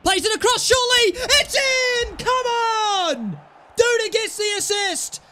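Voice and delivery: high, loud joyful shouting; real excitement; goal celebration. Real, not acted. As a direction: roar as the ball goes in.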